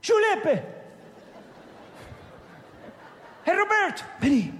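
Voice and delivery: high, loud Italian call to his men; Italian accent, calling servants. Retold or imitated. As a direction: summon Giuseppe and Roberto loudly. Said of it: "el italiano este dijo".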